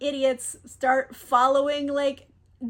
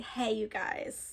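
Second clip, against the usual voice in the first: much softer, hushed aside; calmed down; 3 semitones lower, 9 dB quieter than usual. Retold or imitated. Caliban halts the slapstick to pitch his idea, calm and hushed. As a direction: draw them in quietly with a plan. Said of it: "calms down all the slapstick for a second".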